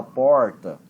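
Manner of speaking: heavy countryside caipira R in "porta", drawn out slowly; caipira accent. Shown as a demonstration. Teacher showing a student the R to borrow for English, caipira accent. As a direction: say "porta" with a thick rural Brazilian caipira R. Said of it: "aquele sotaque carregado de caipira".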